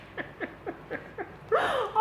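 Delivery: sudden real laugh; high burst of laughter. Real, not acted. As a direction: crack up laughing. Bursts out laughing mid-story.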